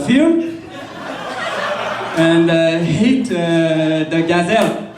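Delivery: French Canadian narrator. Retold or imitated. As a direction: stumble through it like GSP narrating. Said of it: "with a French Canadian accent".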